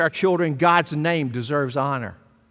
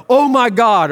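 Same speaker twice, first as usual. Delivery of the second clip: mock exclamation; 6 semitones higher, 6 dB louder than usual. Mocking movie exclamations.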